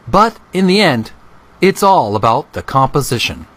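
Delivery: a measured, careful quotation read aloud; quoting the artist from the book, slow and lifted; quoted reading. A narrator's reading.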